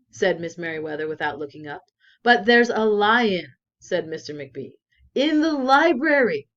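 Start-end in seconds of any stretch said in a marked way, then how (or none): 2.2-3.5 loud, slow, alarmed. A narrator's reading. Librarian alarmed, telling his boss about the lion. 5.1-6.5 higher and loud, alarmed. A narrator's reading. Librarian alarmed, telling his boss about the lion.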